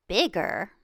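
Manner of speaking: usual speaking voice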